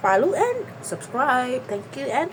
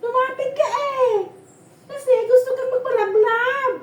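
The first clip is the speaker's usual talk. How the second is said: much higher, louder, laughing coax; high excited coaxing; 11 semitones higher, 6 dB louder than usual. Real, not acted. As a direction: squeal it at the pet with a laugh. (mataas at malakas na paglalambing habang tumatawa)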